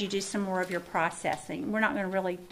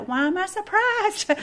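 mock self-surprise; 11 semitones higher, 7 dB louder than usual. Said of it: "And then you're gonna go".

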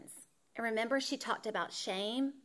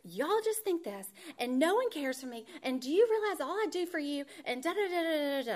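higher, hammed-up ranting to the family; mock angry rant; 5 semitones higher than usual. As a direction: overdo the hurt mother's tirade. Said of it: "I let them know".

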